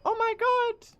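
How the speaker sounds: very high squeaky German accent; hammed-up high German voice, dragging the last words; mock German speedrunner. Shown as a demonstration. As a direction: squeal it like an overwrought German gamer. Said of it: "And there's that".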